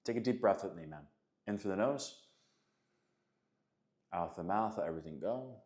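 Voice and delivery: soothing meditation cue, softly spoken; guided breathing. Acted. Leads a breathing exercise.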